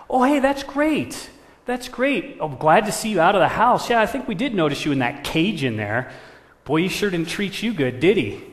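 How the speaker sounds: loud, high, animated crow voice; exaggerated high-pitched friendliness; chummy scheming crow. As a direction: play up the enthusiasm to win over a newcomer.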